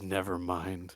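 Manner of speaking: low, soft withdrawal; imitating a man mumbling his way out; deflated retreat. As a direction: back down quietly. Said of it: "He turns away".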